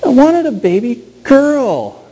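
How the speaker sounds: put-on high, slow child's lament; little girl's voice. Daughter telling her parents why she's upset, high and slow. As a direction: whine it like a disappointed four-year-old. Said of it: "We're like".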